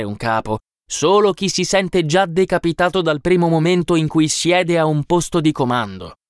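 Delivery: a hint of fervent, principled argument; learned man correcting. A narrator's reading. Bespectacled man corrects the others on chiefs, lightly fervent lecturing.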